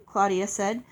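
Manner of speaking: ordinary delivery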